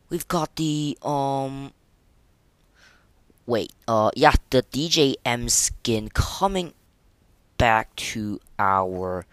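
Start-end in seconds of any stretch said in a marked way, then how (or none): none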